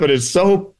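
ordinary talk, nothing marked

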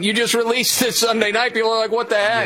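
high-pitched disbelieving complaint; mock incredulous. People reacting to a Sunday night release, high mock disbelief. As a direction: pitch it up in exasperated bafflement.

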